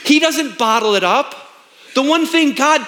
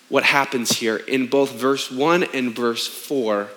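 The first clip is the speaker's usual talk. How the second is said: ordinary talk, nothing marked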